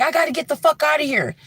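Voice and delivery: fast, high, frantic; panicked rush. Retold or imitated. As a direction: blurt it out desperate to escape. Tommy panicking, looking for phone.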